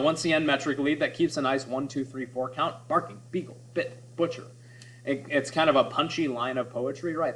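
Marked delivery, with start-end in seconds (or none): 2.9-4.4 each B word set apart and stressed; picking out the B words. Shown as a demonstration. Teacher listing the B words to show alliteration, each stressed. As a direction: tap out every B word on its own. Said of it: "that B sound".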